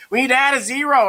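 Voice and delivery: high, loud pupil imitation; student voice. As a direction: play an eager kid calling out the answer. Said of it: "what else do we need".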